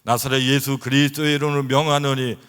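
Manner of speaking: loud, forceful healing command; commanding prayer. Retold or imitated. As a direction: cast out the sickness with authority. (크게 외치는 치유 명령 기도)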